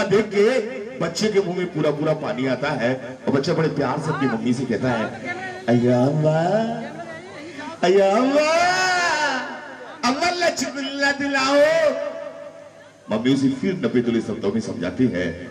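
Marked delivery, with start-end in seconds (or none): 5.7-12.0 put-on high, pleading little child's voice; childish begging whine; whiny child. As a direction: wheedle like a small kid begging for a balloon.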